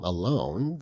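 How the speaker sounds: plain reading voice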